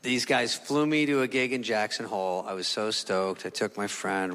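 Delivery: ordinary delivery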